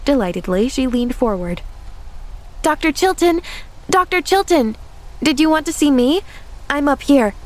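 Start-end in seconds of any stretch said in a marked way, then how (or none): 2.6-7.3 put-on high, eager child's call; delighted calling out. A narrator's reading. Delighted girl calls down to the doctor from upstairs, high and eager. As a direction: call down eagerly to someone below.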